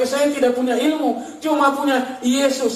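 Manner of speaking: drawn-out proclamation on the last words; defiant declaration. Retold or imitated. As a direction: declare it firmly to accusers. Defiant answer to accusers, retold.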